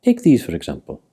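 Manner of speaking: ordinary talk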